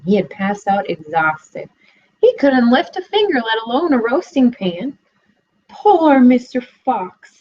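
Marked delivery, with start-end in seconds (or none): 5.7-7.4 sighed. A narrator's reading. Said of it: "sighed the piglet".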